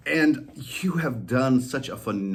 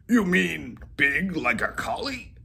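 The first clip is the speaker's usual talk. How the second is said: higher, lightly voiced collie; dog voice asking; 7 semitones higher than usual. Molly asking Whiskers, higher dog voice. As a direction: ask the cat, puzzled. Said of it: "Molly asked".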